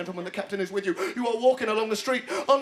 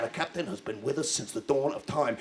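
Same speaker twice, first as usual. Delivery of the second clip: ordinary talk, nothing marked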